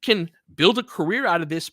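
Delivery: ordinary delivery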